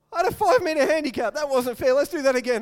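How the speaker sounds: sulky protest. Retold or imitated. Complaining after coming third.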